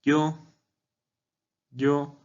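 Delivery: "yo" high and even, no movement in pitch; first tone. Shown as a demonstration. Teacher repeating "yo" for learners, high level first tone. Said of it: "en primer tono diría yo".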